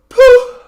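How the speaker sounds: explosive poof from the belly; sharp poof breath. Shown as a demonstration. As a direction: blast a short poof of air. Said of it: "much faster".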